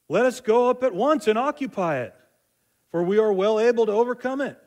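high, loud, bold call to take the land; rallying call. Retold or imitated. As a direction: rouse the crowd to charge in. Caleb rallies the people.

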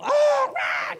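hammed-up bird shriek; vulture shriek. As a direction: screech like a vulture for a laugh. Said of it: "it's like a shrieking".

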